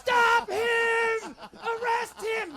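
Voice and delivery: high, loud, slow screaming; hysterical shriek. Retold or imitated. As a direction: shriek for the police to grab him.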